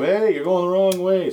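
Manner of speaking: high-pitched mock call-out; mild retold yell. Yelling at a limo going the wrong way, retold as a mild yell. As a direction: call out without really shouting. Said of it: "we both yelled".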